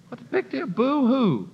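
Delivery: a hint of a sulking critic, mocking; mocking whiner. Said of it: "You say".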